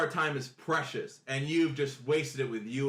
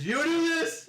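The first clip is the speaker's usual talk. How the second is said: accusing; 4 dB louder, slower than usual. Acted. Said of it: "And they turn to you".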